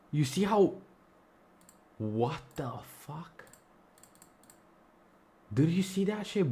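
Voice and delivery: can't believe what just happened on screen; shocked disbelief. Real, not acted. Streamer to chat as his pairs vanish, stunned and loud. Watch list suddenly vanishes.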